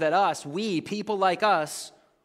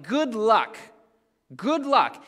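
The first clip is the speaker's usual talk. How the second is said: drawn-out, higher-pitched scoff; skeptical good luck; 5 semitones higher, 4 dB louder, slower than usual. Real, not acted. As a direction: stretch it out in disbelief. Scoffing at congregation accepting stutterer.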